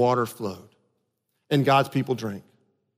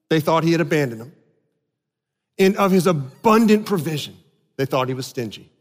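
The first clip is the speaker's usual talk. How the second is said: normal talking voice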